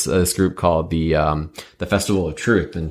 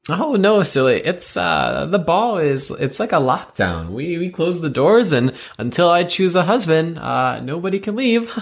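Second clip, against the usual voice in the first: high, sweet princess voice; cartoon princess; 10 semitones higher than usual. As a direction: play a bubbly cartoon princess.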